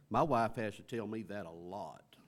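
usual speaking voice

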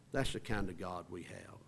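hushed whisper to the congregation. Acted. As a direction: breathe it like a secret. Intimate close after slow line.

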